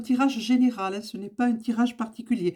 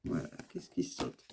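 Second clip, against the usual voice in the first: chuchoté; 12 dB quieter than usual. Real, not acted. Aside while drawing a card.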